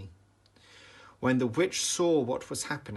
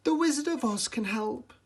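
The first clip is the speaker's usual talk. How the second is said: Glinda; 13 semitones higher than usual. A narrator's reading. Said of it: "replied Glinda".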